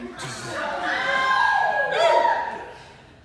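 loud guest laughter; a big laugh from the room; crowd bursts out laughing. Real, not acted. Wedding guests laughing loudly at the jab. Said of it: "crowd laughs".

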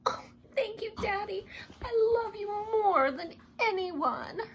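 high, gushing thanks; grateful little girl. Acted. Olivia thanking her father, high and gushing. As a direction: melt with gratitude toward daddy.

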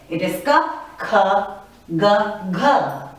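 "ka" plain, "kha" with a breathy H puff; each second sound pushed out with an H; unaspirated then aspirated. Shown as a demonstration. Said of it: "you give a stress when you pronounce the second one".

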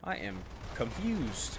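slow, quiet, measured statement; mock solemn. Streamer baffled by his faction's moves, mock-solemn slow delivery. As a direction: spell out the bafflement slowly and softly.

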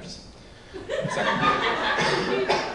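high, slow wheezing laugh; wheezy high laugh. Acted. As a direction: stretch it into a wheeze. Stretched high breathy laugh.

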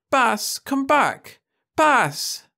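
very high, loud drawn-out cry; frantic girl. A narrator's reading. Daughter crying out to her vanished idol, high and desperate. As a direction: call after her lost idol in panic. Calling frantically to broken screen.

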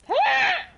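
high screeching seagull cry; seagull squawk. Pretend. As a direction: squawk like a gull. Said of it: "imitate a seagull for me".

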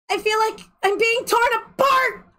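high, frightened pleading; panicked high cry. Acted. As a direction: beg for help in terror. Acted panicked girl in pain.

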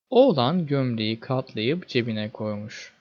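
ordinary delivery, nothing marked

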